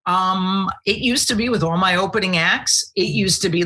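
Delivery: normal talking voice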